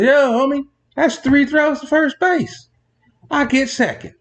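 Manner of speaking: a runner cockily claiming second base from the umpire; cocky runner. Retold or imitated. Runner tells the umpire he gets second, cocky swagger. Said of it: "The runner looks at the umpire and says".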